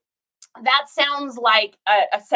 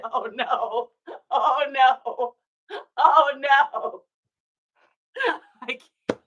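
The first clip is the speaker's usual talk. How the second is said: laughing groan; much slower than usual. Real, not acted. Cringing at her old description.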